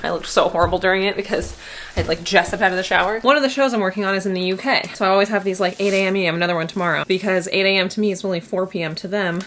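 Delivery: ordinary delivery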